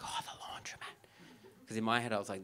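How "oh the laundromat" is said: hushed, awed whisper; breathy-soft private thrill; dreamy whisper. Acted. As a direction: whisper it like a romantic daydream. Dreamy inner reaction to suggestion.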